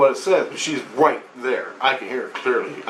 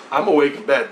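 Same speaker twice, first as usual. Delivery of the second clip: ordinary delivery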